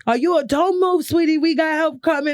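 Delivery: high-pitched, reassuring Brooklyn Italian stranger; thick Brooklyn Italian accent. Retold or imitated. Man telling fainted woman help is coming, Brooklyn Italian accent. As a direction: put on a Brooklyn Italian rescuer's voice. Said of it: "Brooklyn Italian accent".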